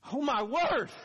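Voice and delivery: loud, high gasp of shock; startled cry. Pretend. Women at the tomb crying out, loud and high in shock. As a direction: blurt it out as if struck.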